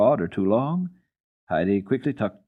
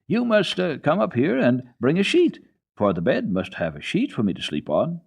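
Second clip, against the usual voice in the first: put-on lightly raised, eager little girl voice; Heidi as a child; 7 semitones higher, 4 dB louder than usual. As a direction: call up like an excited child.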